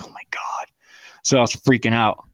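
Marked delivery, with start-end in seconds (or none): 0.0-0.7 breathy stunned whisper; a hushed, horrified aside; whispered oh my God. Retold or imitated. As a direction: whisper it in shock. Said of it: "I was like".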